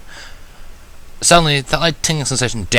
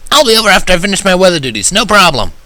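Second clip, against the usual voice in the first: much higher, louder and fast, eager; brash Rainbow Dash; 10 semitones higher, 6 dB louder than usual. Acted. Rainbow Dash promises to help after weather duties, loud and brash. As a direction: toss off the promise, cocky and quick.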